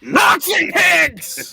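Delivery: slow drawn-out mob yell; angry crowd chant; mob shout. Acted. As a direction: bellow it like a rioter in the street.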